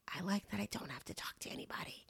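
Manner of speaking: breathy confessional whisper. Real, not acted. To viewers, admitting she'd rather not talk: whispered. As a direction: drop to a secret whisper, owning up. Confessing she avoids talking to cashiers.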